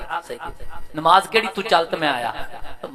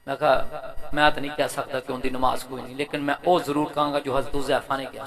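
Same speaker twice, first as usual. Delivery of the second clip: low, soft, humble reply; subdued low-pitched explanation; hushed preacher's retelling; 4 semitones lower, 5 dB quieter than usual. Retold or imitated. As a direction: answer quietly, disclaiming authority. Retells own modest answer.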